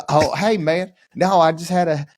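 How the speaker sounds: high, put-on Southern voice; hammed-up Southern apology, pitched high; Southern accent. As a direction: play a sheepish role player in mock Southern. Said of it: "still have a Southern accent".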